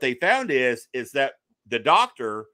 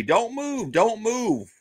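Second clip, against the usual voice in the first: high-pitched, insistent reenacted warning; urgent doctor's order; 9 semitones higher, slower than usual. Doctor telling patient to stay still, high imitated urging. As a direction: urge a patient to stay still, slowing at the end. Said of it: "And the doctor say".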